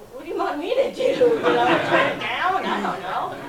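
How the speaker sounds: high, loud mock shrug; a hammed-up careless guide; shrugging guide. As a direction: play the guide as loudly unbothered. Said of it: "And he's like, looking at me like".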